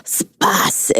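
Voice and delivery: lightly put-on, hushed catchphrase; Stefan from SNL. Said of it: "Stefan S N L".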